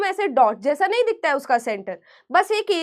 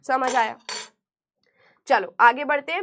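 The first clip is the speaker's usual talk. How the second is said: ordinary talk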